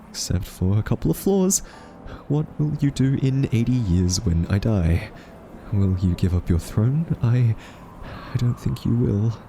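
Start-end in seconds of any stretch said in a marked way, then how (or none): none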